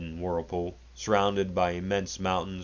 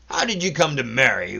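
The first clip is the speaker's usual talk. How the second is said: mocking; 7 semitones higher, 7 dB louder than usual. A narrator's reading. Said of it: "less brutal and more mocking".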